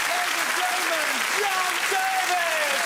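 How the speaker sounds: high, loud, stretched showman's announcement; announcer call. Acted. Host hyping the crowd, loud drawn-out call. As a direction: bring him on big.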